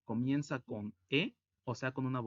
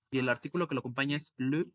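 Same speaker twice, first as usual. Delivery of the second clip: normal talking voice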